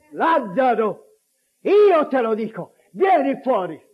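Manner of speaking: firm, ringing summons; commanding cry. Acted. Jesus telling the dead man to rise, commanding. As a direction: call him out with authority, pausing between phrases.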